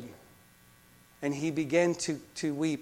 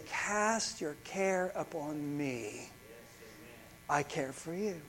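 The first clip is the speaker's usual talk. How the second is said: slow, soft reassurance; gentle invitation; 7 dB quieter, much slower than usual. Acted. Preacher quoting God's promise to the congregation, gently. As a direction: ease the words out slowly and quietly.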